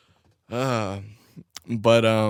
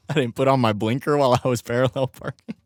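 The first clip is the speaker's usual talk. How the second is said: ordinary talk, nothing marked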